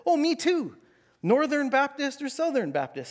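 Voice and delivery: high, eager and chummy; a hammed-up overeager believer; eager me too. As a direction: jump in, keen to agree. Eager narrator in the joke.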